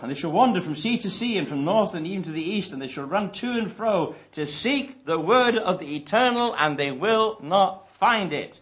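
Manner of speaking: solemn scripture reading. Real, not acted. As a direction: proclaim the verse to the congregation. Reading scripture aloud with force.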